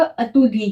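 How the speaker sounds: "अतुदिए" starting with uh: uh-tu-dee-ay; unaccented first vowel. Shown as a demonstration. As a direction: open "अतुदिए" on a neutral schwa. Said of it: "ई को अपन अ करके pronounce".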